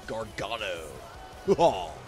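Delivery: normal talking voice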